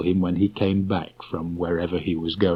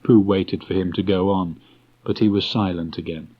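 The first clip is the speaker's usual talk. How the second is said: ordinary delivery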